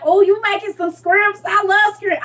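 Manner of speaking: high, excited southern voice, "scrimps" for shrimps; southern woman's accent. Retold or imitated. Southern guest in her house, high excited southern accent. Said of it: "she was like".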